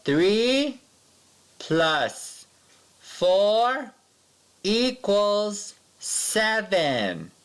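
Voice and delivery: high, slow, stretched equals seven; read-along equation. Shown as a demonstration. Teacher reads the equation for pupils to echo, slow and high. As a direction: model each word for the class to repeat. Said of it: "Read it with me".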